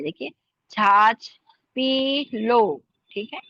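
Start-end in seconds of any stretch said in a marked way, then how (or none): 0.7-2.8 छाछ with a strong aspirated छ, said slowly; aspirated chh, slow. Shown as a demonstration. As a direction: stretch out छाछ पी लो with a puff on छ. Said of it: "ये भारी है". (धीरे और भारी छ के साथ छाछ पी लो)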